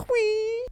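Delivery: sudden very high squeal. Real, not acted. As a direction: let out a startled squeak. Startled squeal in the diner.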